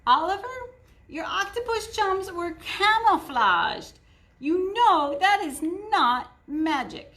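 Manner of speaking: put-on older sister lecturing a kid magician; bossy sister. A narrator's reading. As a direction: talk down to a little brother. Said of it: "Sister says".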